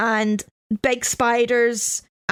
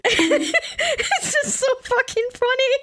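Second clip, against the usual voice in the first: high, slow, laughing as they speak; helplessly amused, pitched well up; real laughing; 13 semitones higher, slower than usual. Real, not acted. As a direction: squeeze the words out through real laughter. Cracking up at the clip.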